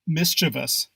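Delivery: Mischievous said MIS-chuh-vus; no ee after the V in Mischievous; three-syllable mischievous. Shown as a demonstration. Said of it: "Proper pronunciation is mischievous".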